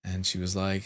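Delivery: normal talking voice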